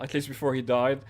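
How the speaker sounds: normal talking voice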